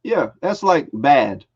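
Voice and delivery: normal talking voice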